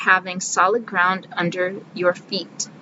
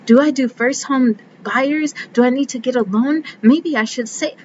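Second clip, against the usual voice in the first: higher, racing anxious self-questions; panicked inner voice; 5 semitones higher than usual. Retold or imitated. House-hunting worries racing in her head, panicky and higher. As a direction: spiral into panic inside your head.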